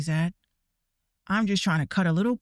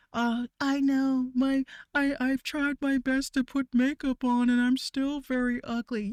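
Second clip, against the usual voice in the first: mock sad victim; 6 semitones higher than usual. Streamer mocks a Dhar Mann victim, higher and pitiful. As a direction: play the over-the-top bullied kid. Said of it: "Dhar Mann videos".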